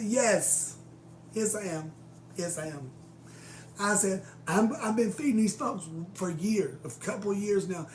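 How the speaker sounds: loud, high, fed-up insistence; defiant shout. Retold or imitated. Answering the boss who wants the homeless gone, loud defiance. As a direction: stand your ground hard.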